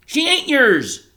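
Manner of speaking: put-on high, loud, slow furious boy's scream. A narrator's reading. Bryce screaming at father over sister's body, furious scream. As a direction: scream it to stop him taking her. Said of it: "Bryce screamed".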